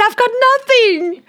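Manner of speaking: very high, loud exclaim; squeaky high mock helplessness; comic squeak. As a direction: throw it up in mock despair.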